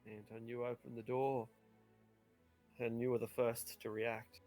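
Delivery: normal talking voice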